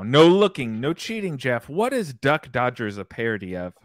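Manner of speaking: ordinary talk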